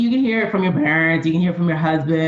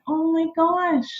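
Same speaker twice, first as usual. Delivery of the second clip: touched; 11 semitones higher than usual. Retold or imitated. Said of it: "that to me was just like".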